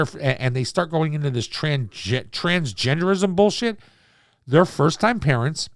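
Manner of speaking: ordinary talk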